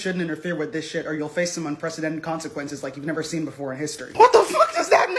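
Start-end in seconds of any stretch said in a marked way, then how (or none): 0.0-4.1 low, quiet, menacing put-on voice; mock Putin threat. Mocking Putin's warning to other countries, low and menacing. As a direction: drop low and soft like a villain. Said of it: "didn't Putin say some shit like".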